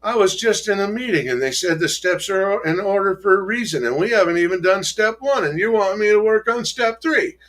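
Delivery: a put-on whiny voice; mock whiny complaint. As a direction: whine it like a sulky newcomer. Quoting himself complaining in meeting.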